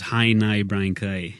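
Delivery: "Hi, nigh" with I vowels, northern Irish; northern Irish accent. Shown as a demonstration. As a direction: slide the vowels to I, Ulster style. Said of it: "the vowel changes to an I".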